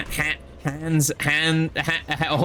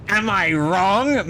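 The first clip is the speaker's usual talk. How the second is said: slow, louder, rising courtroom plea; courtroom meme; 5 semitones higher, 4 dB louder, much slower than usual. Retold or imitated. Streamer quoting the courtroom meme, slow and pleading. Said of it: "standing up in courtroom meme".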